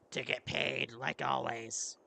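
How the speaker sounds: very low, rough warrior voice; gruff warrior. Acted. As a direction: answer low and gravelly, unimpressed. Plays the replying character.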